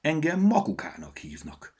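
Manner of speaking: a lightly voiced squirrel. A narrator's reading. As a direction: introduce yourself as a lively squirrel. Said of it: "felelte a mókus".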